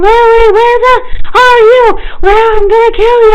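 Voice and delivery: very high, loud tearful screech; full-force retold hysterical yelling; hysterical scream. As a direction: scream for your child, crying and furious. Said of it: "hysterically crying, and I'm, like, screaming".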